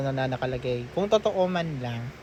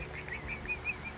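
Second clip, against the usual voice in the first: kilig squeal; 14 dB quieter than usual. Real, not acted. Kilig squeal over smiling girl.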